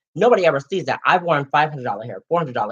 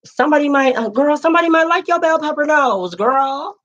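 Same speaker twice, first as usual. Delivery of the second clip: sassy high voice; 10 semitones higher than usual. Acted. Sassy high-pitched riff on bodies.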